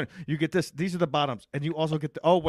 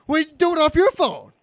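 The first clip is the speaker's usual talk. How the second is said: mimicking voice; 10 semitones higher, 4 dB louder than usual. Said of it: "So Kevin said".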